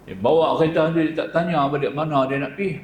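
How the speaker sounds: usual speaking voice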